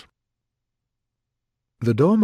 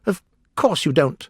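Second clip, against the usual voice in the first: higher-pitched, scornful Hatter; contemptuous; 7 semitones higher than usual. A narrator's reading. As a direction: dismiss them with contempt. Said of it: "the Hatter said with contempt".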